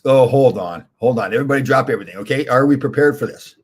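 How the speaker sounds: loud, eager call for everyone to stop; excited call-out. Real, not acted. Host stops the stream to rally viewers, excited loud call.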